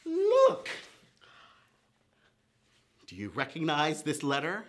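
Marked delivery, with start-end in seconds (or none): 0.1-0.7 excited. Pretend. Showing the letter.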